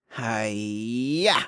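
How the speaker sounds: drawn-out karate cry, lifting at the end; pixie-like assassin's attack cry, not full volume; mild battle yell. A narrator's reading. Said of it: "the diminutive pixie-like assassin yelled".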